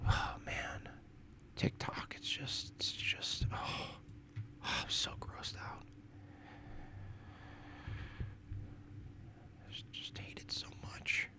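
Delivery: whispered disgust; whispering. Real, not acted. Streamer whispering disgust to himself, hushed. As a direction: murmur it with no voice. Grossed out aside off-mic.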